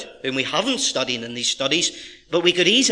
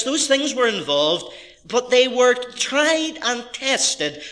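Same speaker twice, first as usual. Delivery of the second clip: higher-pitched, insistent sermon delivery; emphatic preaching; 7 semitones higher than usual. Real, not acted. Pastor to congregation on suffering in God's service, emphatic preaching. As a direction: drive the point home with weighted words.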